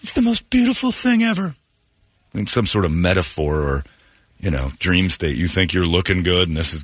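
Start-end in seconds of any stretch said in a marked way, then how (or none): 0.0-1.5 much higher, sing-song sarcastic gush; mocking the idea that childbirth is beautiful, high gushing. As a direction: parody the sappy mom line. Sarcastic mock of childbirth sentiment.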